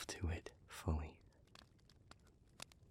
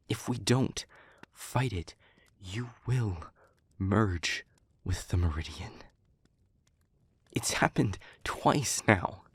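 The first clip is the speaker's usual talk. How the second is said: raised voice, hammering out each phrase; forceful warning; 5 dB louder than usual. Acted. Speaker warns partner they will merge with the Meridian, forceful. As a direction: insist loudly, in hard separate bursts. Insistent warning in acted argument.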